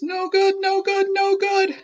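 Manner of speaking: high, loud, repeated complaint; Ricky bouncing and complaining; grumbling rock. Acted. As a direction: chant it like a stuck record. Ricky failing to roll.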